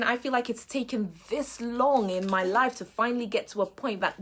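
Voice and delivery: normal talking voice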